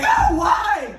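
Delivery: high, loud anguished wail; hammed-up despairing scream; wailing in agony. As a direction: cry out to the heavens. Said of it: "just like".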